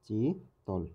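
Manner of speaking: "চিতল" in two parts, chi, then tol; teacher dividing "চিতল" as চি — তল; split into syllables. Shown as a demonstration. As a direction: break "চিতল" after চি with a pause. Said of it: "কীভাবে ভাঙা যায় কি ভাঙা যায় না".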